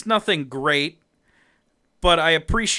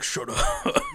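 whispered, embarrassed brush-off; hushed mock-defensive hero; Batman. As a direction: hiss it under your breath. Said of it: "And he's like".

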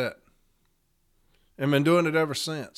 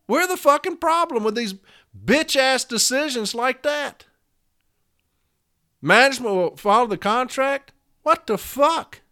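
high-pitched angry disbelief; exasperated outrage; 8 semitones higher than usual. Real, not acted. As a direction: voice climbing in indignant fury. Angry rant at weak decisions.

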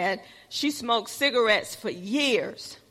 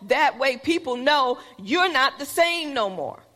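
ordinary talk, nothing marked

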